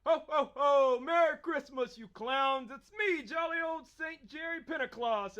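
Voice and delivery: loud, high, booming Santa; jolly Santa. Acted. Santa character arrives.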